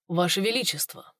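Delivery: flat, toneless address; colorless tone. A narrator's reading. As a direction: say it with no feeling. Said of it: "бесцветным тоном обратился Цинфан".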